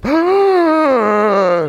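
loud, high, drawn-out gasp; exaggerated shocked inhale; collective gasp. Shown as a demonstration. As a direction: imitate thirty students inhaling in shock. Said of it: "inhale from thirty students that sounded like this".

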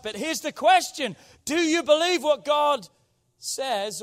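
high, loud, forceful question; loud preaching challenge. Real, not acted. Preacher asking the church what they believe, loud challenge. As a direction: throw the question out to the congregation.

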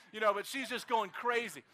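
ordinary delivery

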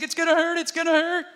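high-pitched mock whimpering wail; crying child. Dad imitating his son dreading the wooden spoon, crying. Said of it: "He's crying, he's screaming, he's saying".

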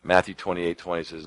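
ordinary delivery

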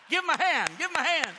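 loud call for applause; rally the room to clap; preacher loudly calls the crowd to applaud. Real, not acted. Calling for applause.

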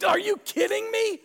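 comic incredulous outburst. Real, not acted. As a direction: squeal it in disbelief. Preacher imagines spit-take reaction.

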